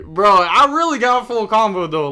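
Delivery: real excited protest; indignant protest. Real, not acted. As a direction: insist it really happened. Protesting to chat, full combo.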